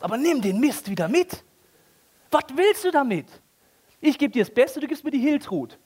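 high, loud exasperated voice; indignant God. Acted. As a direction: scold the cheap giver. (hoch, empört)

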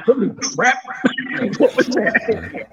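lightly acted shock breaking into a laugh; slow, amused disbelief; startled. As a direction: replay the jolt of hearing it, half laughing. Retelling shock at spirit box.

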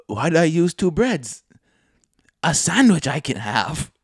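lightly voiced, oddly lilting quote of a friend; odd poetic phrasing. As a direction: recite it like a strange little haiku. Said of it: "Are you Jack Sparrow? Why are you talking like that?".

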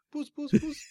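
high-pitched repeated cat call. Pretend. Co-host joking about covering the insult, high cat-calling voice. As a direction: pretend to summon a cat in a high voice. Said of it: "I'll just call him a cat".

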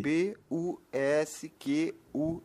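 each letter set apart, slowly; spelling slowly. Acted. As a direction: spell it out clearly for a learner. Translator spells the surname. (soletrando devagar, letra por letra)

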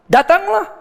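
high, loud call across the water. Acted. Jesus calling Peter onto the water, high and loud. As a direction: call him to come.

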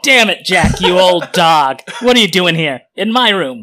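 loud, high acted indignation; startled outburst. Chuck finds Jack in his room, startled loud outburst. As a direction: exclaim it like someone caught off guard.

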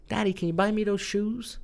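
high, lightly voiced little boy pleading; a hint of a young son asking his dad for sneakers. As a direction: imitate a small kid asking dad for shoes. Son's request voiced in retelling.

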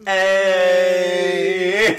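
excited cheer. Real, not acted. Cheer for getting tipsy.

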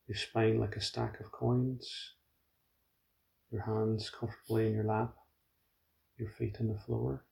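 soft, even meditation voice with long pauses; hushed, unhurried settling instructions; guided meditation. Real, not acted. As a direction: guide them gently into body awareness, quietly.

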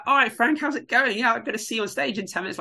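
imitating an upbeat hello to a star; cheery greeting. Greeting [name] in the story.

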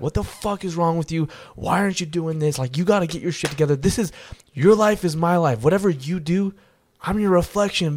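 high, pressing mock rebuke; mad at himself. Reflection scolding him in the mirror, retold exasperated rebuke. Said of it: "getting mad at myself".

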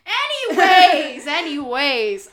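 loud, slow laughing anyways. Real, not acted. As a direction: drag it out mid-laugh. Laughing redirect.

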